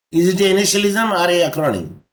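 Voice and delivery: usual speaking voice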